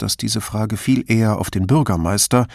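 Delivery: usual speaking voice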